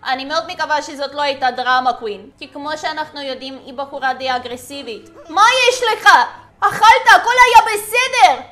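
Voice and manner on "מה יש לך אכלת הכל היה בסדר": high, loud, baffled outburst; exasperated questioning. Acted. Player questions her collapsed game character, loud exasperation. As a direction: demand to know what's wrong. Demands what is wrong with character.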